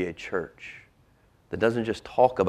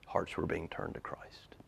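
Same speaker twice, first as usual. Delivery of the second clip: whisper; 13 dB quieter than usual. Real, not acted. Whispered climax of sermon point.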